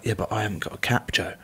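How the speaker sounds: low, quiet mock protest; reluctant reply. Uncapped player excusing himself to a teammate, quietly reluctant. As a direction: drop soft and low, imitating a hesitant teammate.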